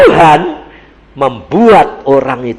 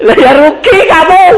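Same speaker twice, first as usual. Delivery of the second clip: laughing rebuke; 9 dB louder than usual. Real, not acted.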